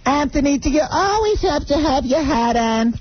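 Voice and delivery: high mock mother-in-law nag. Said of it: "then she's".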